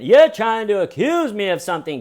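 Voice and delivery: high, loud indignant suspect; once confident tone. Retold or imitated. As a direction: blurt it like a cocky wronged man. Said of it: "tone of voice is taking a turn".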